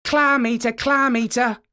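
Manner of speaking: high, loud mocking yell; taunting shout. A narrator's reading. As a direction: jeer at the walrus. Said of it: "shouted as loud as he could".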